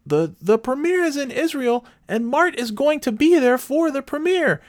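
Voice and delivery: very high caricature of a woman butting in; mock pompous lady. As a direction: imitate her in a piercingly high voice. Acting the wallet-phone lady's reply.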